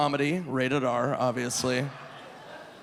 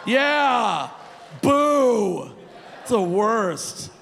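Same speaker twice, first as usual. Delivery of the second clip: mock boo; 6 semitones higher, 4 dB louder, much slower than usual. Mock-booing glasses people.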